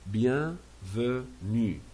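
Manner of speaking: "Bienvenue" very slowly, syllable by syllable, E silent; silent final E. Shown as a demonstration. Teacher showing the silent E, slow syllables. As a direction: stretch "Bienvenue" into bien, ve, nue. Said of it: "We don't pronounce E at the end".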